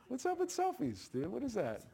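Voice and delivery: high squeaky mocking voice; mock squeal. Preacher mocking selfie takers in a squeaky voice. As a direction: squeak it like a silly teen.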